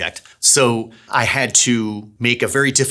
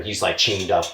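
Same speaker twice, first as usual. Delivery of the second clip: ordinary talk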